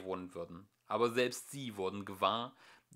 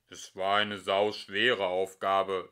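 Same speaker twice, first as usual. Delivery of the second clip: plain reading voice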